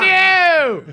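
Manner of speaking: loud, very high, long-held cry; hammed-up protest. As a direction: stretch it into a comic wail. Climax of the retold refusal.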